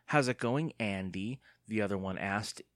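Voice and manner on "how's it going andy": usual speaking voice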